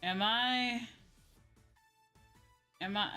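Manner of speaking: normal talking voice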